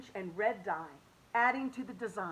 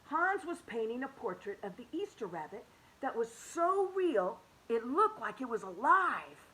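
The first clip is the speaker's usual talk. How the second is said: ordinary delivery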